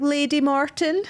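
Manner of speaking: imitating someone calling a full name; acted vet's announcement. Acted vet calling out cat's name.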